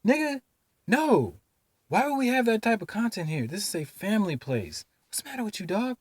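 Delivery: high-pitched, incredulous retort; reflex outraged reaction. Retold or imitated. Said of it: "My reaction was this".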